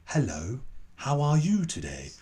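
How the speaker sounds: "Hello, how are you today?" spaced out word by word; clear careful English. Retold or imitated. Expat greeting non-native English speakers, clear careful English. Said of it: "You, you speak clearer".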